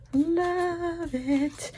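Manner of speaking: high, soft squeal of delight; love it again in a tiny high voice; squeaky gush. Real, not acted. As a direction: coo it like a thrilled collector.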